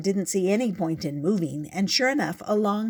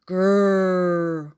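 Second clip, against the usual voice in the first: loud growling roar like a big cat; rumbling beast growl, loud; tiger growl; 8 dB louder than usual. Acted. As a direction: roar it out to scare the boy. Loud scary noise from tigers.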